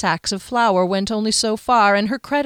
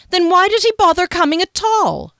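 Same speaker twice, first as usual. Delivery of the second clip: high, loud, haughty challenge; polite sneer; 11 semitones higher, 7 dB louder than usual. A narrator's reading. Village gossip questioning the shopkeeper, polite sneer. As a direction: sneer it politely, pitched well up. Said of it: "lips pursed in a polite sneer".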